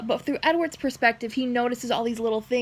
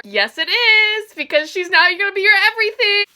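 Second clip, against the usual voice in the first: excited; 6 semitones higher, 5 dB louder than usual. Real, not acted. Fangirling over a quote.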